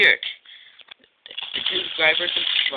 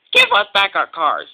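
loud, higher quarrelling protest; indignant demand; 5 semitones higher, 9 dB louder than usual. Acted. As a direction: demand it back loudly. Ben demands cars in quarrel.

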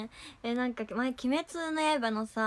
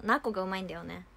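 ordinary talk

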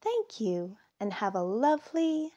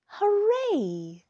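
high, louder hooray; joyful cheer; 12 semitones higher, 4 dB louder than usual. Acted. Cheering when Minka pops out, loud high hooray. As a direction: shout it like the best surprise ever.

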